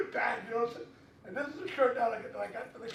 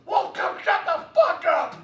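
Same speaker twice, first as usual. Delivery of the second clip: loud, slow, fed-up bellow; angry shout; 7 semitones higher, 13 dB louder, much slower than usual. Retold or imitated. Con security guard yells at the screaming man to shut up. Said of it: "Con security guy goes".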